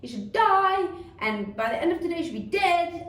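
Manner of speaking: put-on high, loud child's tirade; angry outburst. Child raging about the teacher, acted outburst. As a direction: rant like a furious child, acted. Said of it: "when it's an outburst like that".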